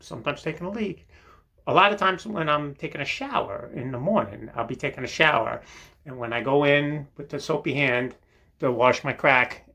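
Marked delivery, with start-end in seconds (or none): none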